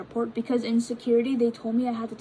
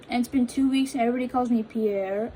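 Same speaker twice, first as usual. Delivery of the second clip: ordinary talk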